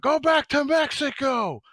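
loud, high, drawn-out yell; yelled heckle. Retold or imitated. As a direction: imitate a heckler yelling from a passing car. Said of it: "he just yells out".

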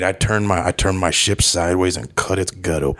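put-on British action-star accent; Jason Statham. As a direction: talk like a laid-back British tough guy. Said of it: "how was his accent".

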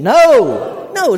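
loud, emphatic NO, pitched high; a forceful, drawn-out NO; with conviction. Shown as a demonstration. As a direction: belt out NO with full conviction. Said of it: "Say it with conviction".

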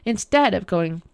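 ordinary delivery, nothing marked

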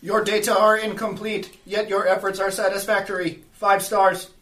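loud, high robotic pronouncement. Acted. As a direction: deliver it like a stiff machine boss. Robo in-character verdict.

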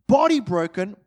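normal talking voice

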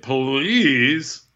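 long "Please" with a rising swoop; drawn-out please. Acted. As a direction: stretch "Please" out and lift the end. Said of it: "No, he said".

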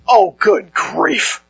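slow, drawn-out mock exasperation; imitating a fed-up guy, slowly; exasperated groan. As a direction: sigh it out like an eye-roll. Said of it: "Rolls his eyes".

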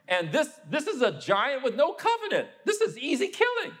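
high, incredulous, bold challenge; indignant young David. Retold or imitated. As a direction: scoff at the frightened army, pitched well up. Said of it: "David is like".

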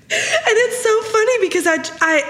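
high, squeaky through laughter; real laughter in the voice; laughing while talking. Real, not acted. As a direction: try to explain while cracking up. Cracking up at her own story.

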